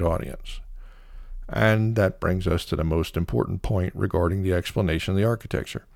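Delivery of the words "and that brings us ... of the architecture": ordinary delivery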